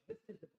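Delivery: soft, high-pitched insistence on IS; emphatic, convincing. Shown as a demonstration. Teacher trying to convince someone, emphatic stress on IS. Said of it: "a little bit more emphasis".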